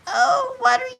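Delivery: high-pitched imitation of old ladies. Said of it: "just these old ladies".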